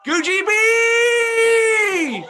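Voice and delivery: long, high, stretched-out cheering shout; a roaring grandstand chant, drawn out; crowd shout. Retold or imitated. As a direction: bellow it like a stadium crowd cheering you on. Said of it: "this is what they're shouting to me".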